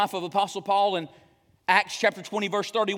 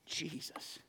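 the name Jesus almost unvoiced, reverent; breathy whisper; 6 semitones lower, 18 dB quieter than usual. Real, not acted. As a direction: let Jesus fall to a hushed breath. Pastor drops to breath on Jesus.

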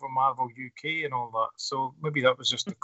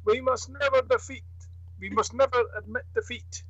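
rousing hero; 8 semitones higher than usual. Retold or imitated. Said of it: "Captain America".

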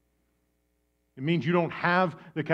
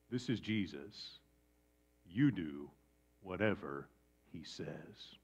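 lower, softer, fading to a whisper; soft firm instruction; 8 semitones lower, 10 dB quieter than usual. Acted. Mary instructing servants, soft firm fading command.

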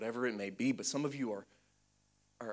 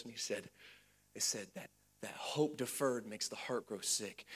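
ordinary talk

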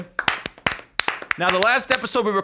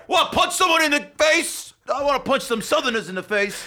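higher-pitched mocking tough-talk; mock boxer chick; 8 semitones higher than usual. Host teasing co-host as a boxer chick, mock tough voice. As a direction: play up a brawler itching to fight. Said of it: "The fucking boxer chick over here".

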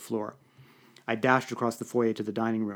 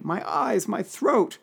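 high-pitched pitiful lament; pathetic moaning; 10 semitones higher, much slower than usual. A narrator's reading. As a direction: moan pitifully about each ailment. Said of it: "she moaned pathetically".

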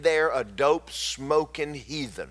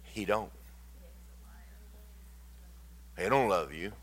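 devil's taunt; 8 dB quieter than usual. Retold or imitated. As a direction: lightly voice the devil whispering doubt. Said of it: "the devil is constantly telling you".

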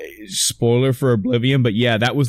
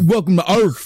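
"Earth" ending in an f, in a higher Chicago voice; mock Chicago accent; 6 semitones higher than usual. Shown as a demonstration. Host mocking the misquote with a Chicago accent, Earth as Urf. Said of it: "He doesn't say, like, he's fucking from the fucking Chicago".

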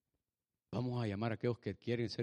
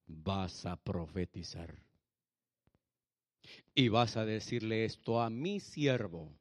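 low, slow, solemn command; grave, stretched-out divine pronouncement; voice of God; 6 semitones lower, slower than usual. Acted. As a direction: intone it slowly and deeply as God. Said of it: "la voz de Dios".

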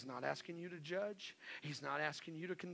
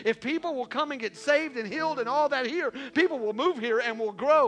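much higher, much louder fiery preaching; full-force preaching; 8 semitones higher, 15 dB louder than usual. Real, not acted. Pastor preaching full force to the congregation. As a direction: preach it at the top of your voice.

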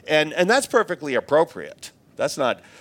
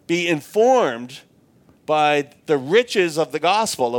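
usual speaking voice

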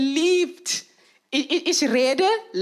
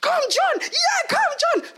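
cheering; slower than usual. Retold or imitated. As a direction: urge the fallen runner on. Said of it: "Ihr sagt". (laut anfeuern)

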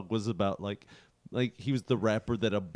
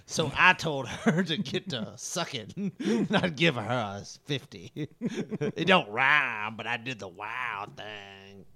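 [name] impression. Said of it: "we can't rhyme all that".

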